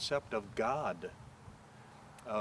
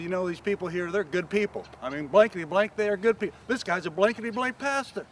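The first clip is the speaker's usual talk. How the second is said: builds higher and louder, ending in a loud, high outburst; a neighbour getting more and more worked up; worked-up rant; 9 semitones higher, 8 dB louder than usual. Retold or imitated. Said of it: "He was getting himself all worked up".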